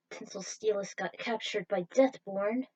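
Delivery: plain reading voice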